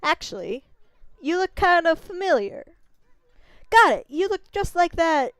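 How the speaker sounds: a brash tiny braggart; Issun voice. Acted.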